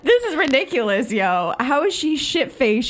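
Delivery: high, laughing retelling of disbelief. Retold or imitated. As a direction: say it while laughing, amazed. Retelling her own reaction at movie.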